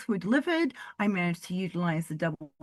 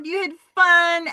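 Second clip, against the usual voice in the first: normal talking voice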